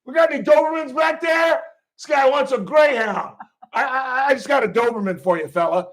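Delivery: high shout to the back, easing off; loud, high-pitched barman impression; hollering Philly bartender. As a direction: bellow across the bar, then settle toward the customer. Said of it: "he just totally did it in the Philly accent".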